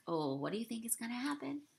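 soft, fast murmured questions; hushed asides. Real, not acted. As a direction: lean in and ask quietly. Quiet asides to listener.

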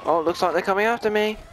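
fast, high alarmed exclaim; excited. Real, not acted. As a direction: blurt it out, startled and rushed. Police chasing him in game.

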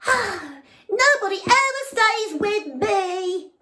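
high, loud lonely lament; loud sad frog. Acted. Lonely frog complaining that nobody stays. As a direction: sigh it out loudly to nobody.

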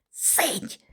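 sharp whispered hush; цитькання пошепки. A narrator's reading. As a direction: shush someone in a whisper. Друг цитькає здалеку, таємничо. (уривчасте шепітне цитькання)

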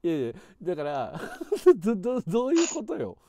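high, loud stammering laugh; nervous laughing. Real, not acted. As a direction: laugh nervously, tripping over words. Caller laughs at sudden silence.

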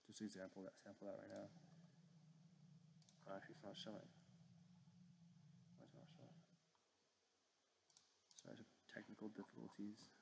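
quiet, distracted self-talk; muttering to oneself. Real, not acted. Muttering to himself during technical trouble.

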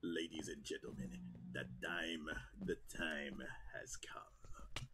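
low, soft, sinister announcement; villain voice. Acted. Gamer announcing the moment, in a low villain voice. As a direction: lure the crowd in like a villain. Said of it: "You sound like Palpy".

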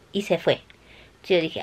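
normal talking voice

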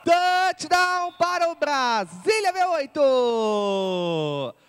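fast build to a long held shout; touchdown call. Real, not acted. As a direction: drag the touchdown out loudly.

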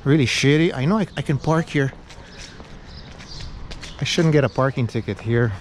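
normal talking voice

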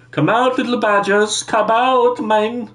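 high-pitched mock German accent. Kidnapper calling badgers out of hiding, mock German accent. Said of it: "saying in a German accent".

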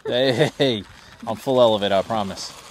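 normal talking voice

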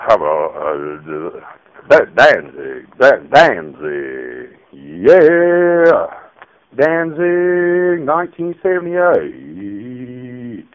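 deep [name] voice. Acted. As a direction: croon the name low and slow. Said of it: "No, that's terrible".

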